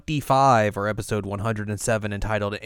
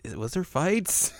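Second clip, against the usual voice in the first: higher, drawn-out puzzled question; 7 semitones higher, 3 dB quieter, slower than usual. Retold or imitated. Host explains the mix-up to co-host, puzzled and high. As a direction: rise sharply into a mock-confused question. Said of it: "I was like".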